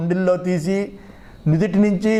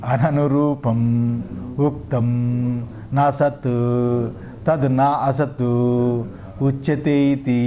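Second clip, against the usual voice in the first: low, slow monotone chant; Sanskrit text recitation; 6 semitones lower, slower than usual. Acted. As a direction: intone each phrase, pausing between them. (సంస్కృత భాష్య పఠనం, నెమ్మదిగా)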